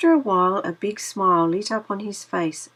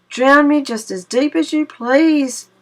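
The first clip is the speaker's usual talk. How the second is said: much higher, louder cry; 7 semitones higher, 7 dB louder than usual. Acted. Brer Rabbit crying out to Brer Fox, higher and louder. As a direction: cry out in fake bravado.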